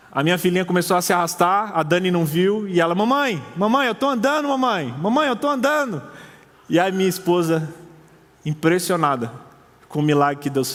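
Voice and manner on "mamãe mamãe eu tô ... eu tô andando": put-on higher, excited little girl calling her mom; joyful child calling. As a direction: call out the news with a child's delight. Daughter calls mother, walking again.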